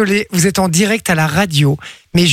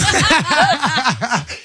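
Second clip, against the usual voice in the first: laugh; 4 semitones higher than usual. Real, not acted. Host laughs again.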